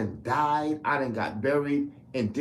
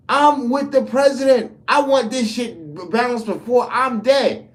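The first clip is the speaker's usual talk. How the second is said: much higher and louder, yelling it out; real shouting; 9 semitones higher, 8 dB louder than usual. Real, not acted. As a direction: shout it like a rally crowd. Escalating political rant, shouting.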